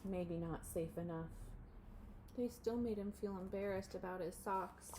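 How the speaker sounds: ordinary delivery, nothing marked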